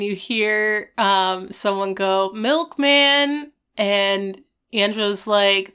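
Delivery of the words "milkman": high, sing-out doorstep call. Retold or imitated. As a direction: announce yourself through a closed door. Someone calls through the door.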